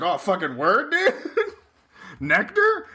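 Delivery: imitating a thrilled gift recipient, pitch jumping up; hammed-up delight, rising high into a laugh; mock excitement.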